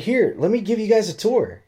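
very high-pitched imitation of a helpful lady; high, sing-along enthusiasm, retold; eager tour offer. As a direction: put on her chirpy eagerness.

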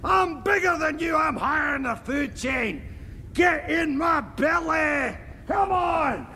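Scottish shouting. Acted. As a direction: roar it at the wee man like a hungry giant. Threatens to eat the little man.

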